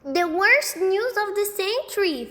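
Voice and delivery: high, loud little boy mimicry; imitating Sheila. A narrator's reading. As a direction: mimic her dramatic wail. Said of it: "doing a perfect imitation of Sheila".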